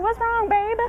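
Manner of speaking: put-on high, kindly Southern country accent; a nurse lady comforting a stranger in a Southern country accent. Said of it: "in her little country accent".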